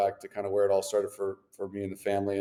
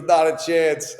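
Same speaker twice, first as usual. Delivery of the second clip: higher, louder cocky jab, lightly voiced; mock taunt; 6 semitones higher, 6 dB louder, much slower than usual. Opponent mocking him after winning the faceoff, cocky taunt. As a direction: toss it off like a smug rival.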